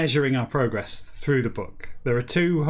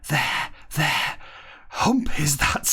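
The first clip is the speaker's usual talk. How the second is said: whispered. Acted. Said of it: "Starbuck whispered".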